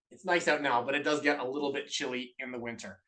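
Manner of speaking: usual speaking voice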